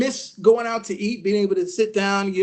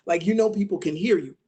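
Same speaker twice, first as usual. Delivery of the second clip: usual speaking voice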